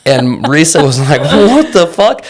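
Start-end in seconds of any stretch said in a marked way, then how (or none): 1.2-2.2 imitated loud, high, drawn-out disbelief; mock outrage. Wife reacting to father's absurd question, mock outrage. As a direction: stretch it out high and loud, aghast.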